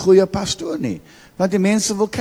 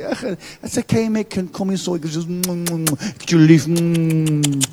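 mock tender pastor soothing a child; a pastor coos over a member's headache, hummed and tender. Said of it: "And all the pastors say".

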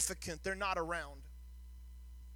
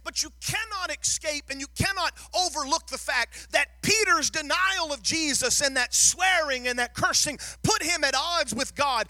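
louder and higher, hammering each point to the crowd; preaching at full force; 5 semitones higher, 8 dB louder than usual. Acted. Pastor pressing the congregation on sin, preaching at full force. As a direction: drive the message home like a revival preacher.

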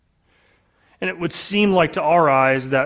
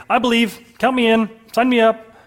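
eager; 8 semitones higher, 6 dB louder than usual. Pretend. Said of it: "I would immediately say".

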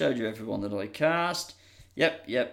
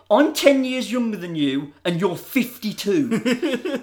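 indignant protest; 6 semitones higher, 3 dB louder, slower than usual. Retold or imitated. Sean indignant at Hogan.

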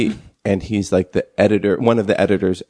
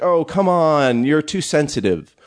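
higher, drawn-out "oh, come on"; mock exasperation; 7 semitones higher than usual. Imitating him answering a moaning audience, mock exasperated. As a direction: imitate a dismissive provocateur brushing off complaints. Acting out his brush-off of audience.

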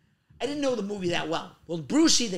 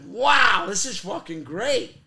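mock thrilled; 9 dB louder, much slower than usual. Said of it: "it's like".

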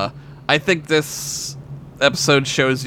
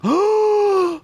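astonished gasp. Real, not acted. Astonished at fun fact.

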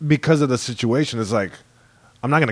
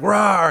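short, higher mock roar; mock bear roar; 7 semitones higher than usual. Storyteller voices the charging bear, mock roar. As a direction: imitate a bear's roar in passing.

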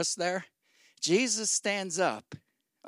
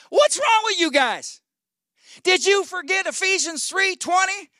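high, loud exasperated scolding; irritated shout; 13 semitones higher, 5 dB louder than usual. Acted. Jesus rounding on his forgetful disciples, irritated shout. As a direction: shout it as if fed up with them. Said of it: "I think he shouted just 'cause he was irritated".

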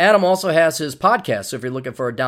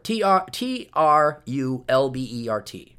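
spelled out slowly; slower than usual. Shown as a demonstration.

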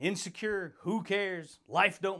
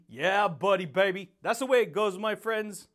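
hyped catchphrase; 4 dB louder than usual. Acted. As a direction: hype the audience up. Shouted catchphrase to viewers.

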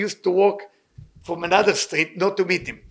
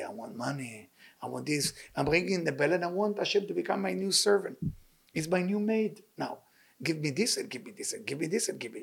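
mock greedy prayer; 8 dB quieter than usual. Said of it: "But I pray that I say".

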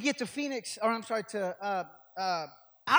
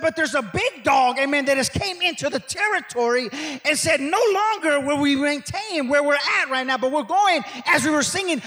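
loud, higher-pitched revival preaching; preaching at full force; 4 semitones higher, 9 dB louder than usual. Real, not acted. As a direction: drive it home to the congregation, building in volume.

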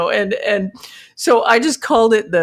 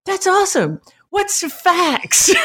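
high, slow, innocent question; high, slow naive young voice; clueless young colleague; 8 semitones higher than usual. Retold or imitated. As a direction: play it wide-eyed and totally sincere. Young admin not knowing faxes.

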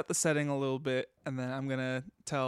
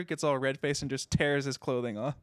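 normal talking voice

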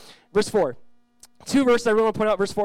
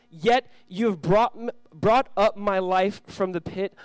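slow scripture reading; 3 semitones higher, 5 dB louder, slower than usual. Acted.

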